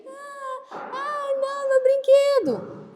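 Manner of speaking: put-on high whiny little-boy wail; mock child crying. A teacher acts a small boy crying over his toy. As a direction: whimper like a child whose toy broke.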